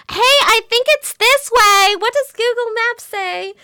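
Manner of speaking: high, slow-starting American tourist voice; American tourist impression. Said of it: "impersonate Americans".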